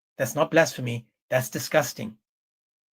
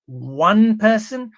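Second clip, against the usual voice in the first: "one person" slowed and louder, pitched up; stress on one person; 5 semitones higher, 5 dB louder, much slower than usual. Real, not acted. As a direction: lean hard on "one person", stretching it.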